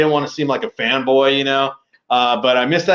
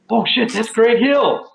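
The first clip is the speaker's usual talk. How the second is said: starstruck surprise; 8 semitones higher, slower than usual. Retold or imitated. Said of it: "and I'm like".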